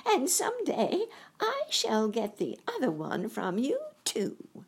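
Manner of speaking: high taunting witch voice. A narrator's reading. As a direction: sneer the threat. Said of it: "laughing at her".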